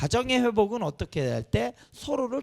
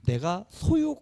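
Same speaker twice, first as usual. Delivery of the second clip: ordinary talk, nothing marked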